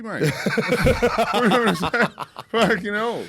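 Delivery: can barely get words out for laughing. Real, not acted. Laughing about iPhone confusion.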